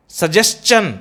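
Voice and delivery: सजेशन with "s-chun" ending; correct pronunciation. Shown as a demonstration. Teacher recapping the examples, correctly pronounced. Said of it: "it is suggestion".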